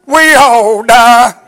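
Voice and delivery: slow, half-sung scripture whoop; preaching at full force. Acted. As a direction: chant the verse like a climax of the sermon. Preacher whoops scripture over music.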